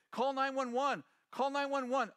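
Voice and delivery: panicked call for help. Retold or imitated. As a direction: pitch it up like a scared husband. Husband panics, wife collapsed.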